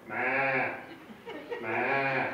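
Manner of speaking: drawn-out goat "meh"; imitated goat bleating; goat bleats. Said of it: "the goats are going".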